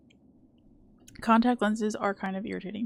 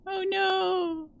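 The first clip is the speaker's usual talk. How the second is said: high squeak; 11 semitones higher than usual. Real, not acted. Game danger.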